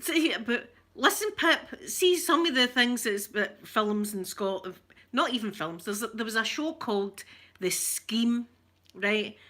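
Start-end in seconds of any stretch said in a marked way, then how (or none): none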